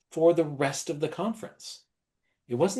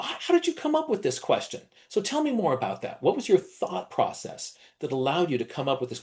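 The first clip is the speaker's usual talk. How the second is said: high, interested teacher voice about the question, much higher; curious praise to a child; 5 semitones higher than usual. Shown as a demonstration. Teacher asking a child about their question, high and eager. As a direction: marvel at the child's question, eager to hear more. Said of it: "I look down at it and I say".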